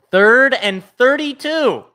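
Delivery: high, slow, loud disbelief; incredulous call. Real, not acted. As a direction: stretch out the absurd distance. Disbelief at huge yardage.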